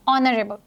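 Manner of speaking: "ऑनरेबल" said from the vowel, h dropped; silent h. Shown as a demonstration. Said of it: "which is ओ". ("ऑनरेबल" बिना ह के)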